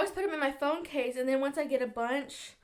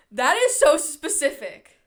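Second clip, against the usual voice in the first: amazed; 3 semitones higher, 10 dB louder, slower than usual. Real, not acted. Reacting to fortune.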